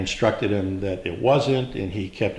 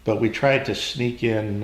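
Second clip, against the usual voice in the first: ordinary talk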